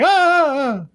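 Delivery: high, drawn-out "ah"; mock scream. Recapping him waking on the bus, mock scream.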